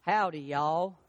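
low, easy hillbilly greeting; hillbilly drawl. Acted. Peter greeting folks in his giveaway accent, hillbilly drawl. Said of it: "He talked like a hillbilly".

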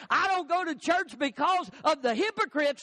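shouted parody of the churchless crowd; mock excuse shouted. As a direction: throw their lame excuse back at them in a shout.

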